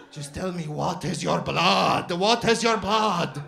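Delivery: Turkish shopkeeper demanding. Retold or imitated. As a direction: press him hard about his heritage. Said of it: "shopkeeper's question".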